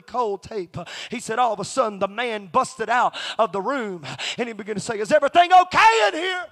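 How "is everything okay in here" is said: high, loud shout bursting in; alarmed shouting. Retold or imitated. Man bursts out of studio to check, alarmed shout. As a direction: holler it as if bursting out of a room.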